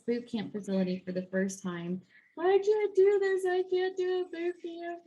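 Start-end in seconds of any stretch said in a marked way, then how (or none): 2.4-5.0 a scared beginner in her car, wailing high through tears; tearful panic. Retold or imitated. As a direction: plead through tears, pitched up. Crocodile tears.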